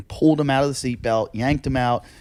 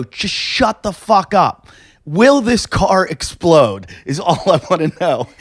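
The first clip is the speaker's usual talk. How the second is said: higher, slow, insistent shout; mock angry shout; 5 semitones higher, 4 dB louder, slower than usual. Comic snapping at the fire crew on the phone, slow angry shout. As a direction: bark it out, slow and fed up.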